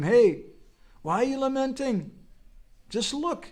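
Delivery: high, loud calling guru. Retold or imitated. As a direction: call him out of his grief.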